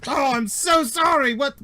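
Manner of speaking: very high, loud flustered robot; startled droid. Acted. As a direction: jump in surprise, then fuss. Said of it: "Oh, drops it".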